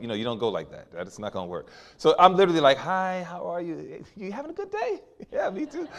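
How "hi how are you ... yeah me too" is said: high, soft, sugary small talk; much higher, softer charming voice; bubbly sweet voice. Retold or imitated. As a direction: bubble them up so they say yes. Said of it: "I'm trying to bubble 'em up".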